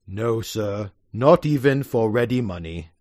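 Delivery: dry deadpan servant; grave butler. Acted. Lane gravely answers his master, low and quiet. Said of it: "Lane says gravely".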